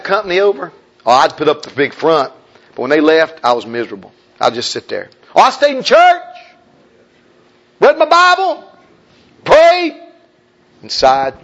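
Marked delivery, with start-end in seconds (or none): 5.3-10.0 high, loud mock churchgoing voice; mock pious. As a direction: act out putting up a pious front, pitched high.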